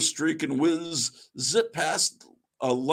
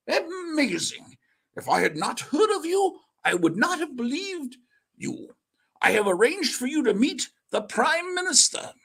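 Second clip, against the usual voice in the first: commandant voice; 10 semitones higher than usual. Acted. Commandant marvelling at Daredevil's story, amazed. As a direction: sound like an astonished British officer.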